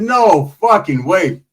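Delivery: loud, slow, drawn-out shock; stunned disbelief. Real, not acted. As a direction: stretch out every word in disbelief. Shocked at trailer blown up.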